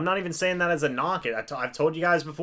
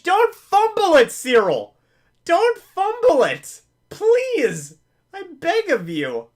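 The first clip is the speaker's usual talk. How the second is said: high, loud begging cry; desperate pleading; 13 semitones higher, 8 dB louder, slower than usual. Real, not acted. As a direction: plead with the player not to fumble. Fan begging his player.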